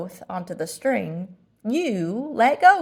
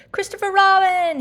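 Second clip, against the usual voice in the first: loud whisper; 12 semitones higher, 7 dB louder than usual. Acted. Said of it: "he said in a loud whisper".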